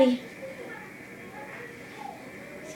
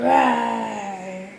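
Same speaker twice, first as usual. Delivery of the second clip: mild roar. Shown as a demonstration. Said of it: "What does a monster say?".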